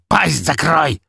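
high, loud angry bark; barking order. A narrator's reading. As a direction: snap at the underling. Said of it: "рявкнул на подчинённого".

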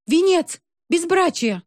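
high shrill accusing shout. A narrator's reading. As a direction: jab the words out, yelling. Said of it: "Любочка ткнула в них пальцем".

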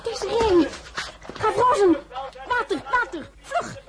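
high, urgent cries for help; panicked calling. Acted. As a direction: call out frantically for water. Mistress fainted, servant calls for help.

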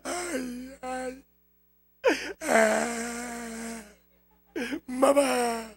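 long, droning fake wail; mock crying. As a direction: imitate someone wailing for mama. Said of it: "Ahí anda llorando usted". (llanto fingido y exagerado)

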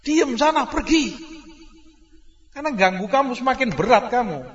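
mock annoyed parent; irritated snap. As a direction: shoo the noisy child away. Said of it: "mangkel kamu kan".

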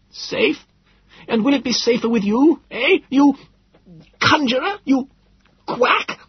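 put-on high, cracking old man's scorn; contemptuous insults. Frightened old Philby sneering at his rescuers, scornful. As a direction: spit each insult at him. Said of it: "hurled those words at me as if they were the vilest insults".

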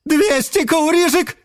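auctioneer finale. A narrator's reading. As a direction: build to the final sale. Auctioneer closing the sale.

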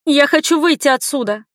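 high, insistent plea; desperate cry. A narrator's reading. Trapped heroine demanding to leave the cellar, desperate cry. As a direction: call out in distress through a door.

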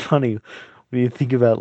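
ordinary delivery

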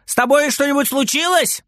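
high called-out question; grandma calling out, high-pitched; calling from kitchen. A narrator's reading. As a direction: call the question through to another room. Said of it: "кричит бабушка из кухни".

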